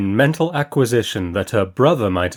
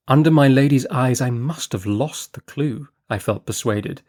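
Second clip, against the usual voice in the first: plain reading voice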